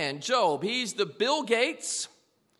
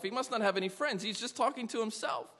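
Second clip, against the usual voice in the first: ordinary talk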